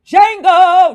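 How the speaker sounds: loud high call of the name. Retold or imitated. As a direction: shout the name across the room. Calling out to character.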